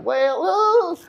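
high, drawn-out country drawl; country accent. Retold or imitated. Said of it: "some fucking country motherfucker".

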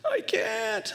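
put-on high-pitched little child's wail; whiny toddler. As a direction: imitate a stuck toddler whining. Toddler wailing behind locked door.

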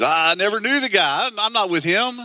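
high-pitched, defensive disowning; acted panicky denial, rising high; crumbling denial. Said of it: "He, he crumbles".

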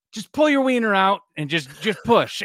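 put-on loud, high exasperated yell at a stalling kid; blowing up. Dad losing patience at his stalling toddler, yelling. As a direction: snap it out, fed up at last. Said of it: "And finally I blew up".

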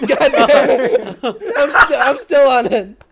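words squeezed out through loud real laughter, slow and high; can barely get the words out for laughing; laughing helplessly. Real, not acted. Laughing at his own story, loud and high.

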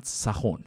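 سخن said as "sakhon"; old Persian "sa-KHON" for سخن; Ferdowsi-era pronunciation. Shown as a demonstration. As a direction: say سخن with a first, then o. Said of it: "فردوسی و هم عصرانش این کلمه رو تلفظ".

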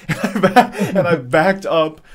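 startled giggle while backing off; laughing startle. Real, not acted. Telling a friend about the mouse, laughing in alarm.

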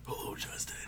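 whispered creepy greeting; Saw voice. Pretend. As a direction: greet him like the Saw puppet. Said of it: "introduce the game with the Saw voice".

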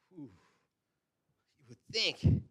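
ordinary talk

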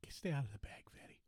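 unvoiced teasing whisper. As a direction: whisper a playful warning. Teasing friend about eating snacks.

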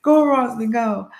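retold roadside cheer; cheering crowd. Street crowd urging the runner on, encouraging call. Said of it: "screaming out your name and encouraging you".

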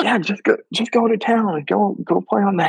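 high, sneaky-sweet urging; sly encouragement. Retold or imitated. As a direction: egg him on with a hidden grin. Tricking friend with beginner key.

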